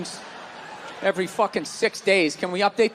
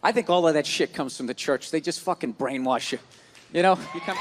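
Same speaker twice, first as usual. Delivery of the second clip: usual speaking voice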